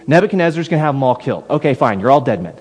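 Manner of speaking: ordinary delivery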